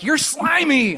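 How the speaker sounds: high and loud disgust; disgusted enemy. Retold or imitated. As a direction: sneer at the fish man. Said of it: "It's like".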